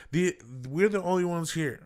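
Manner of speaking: mad customer. Retold or imitated. Customer insisting to the delivery driver, mad. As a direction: imitate an annoyed woman insisting. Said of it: "mad, you know".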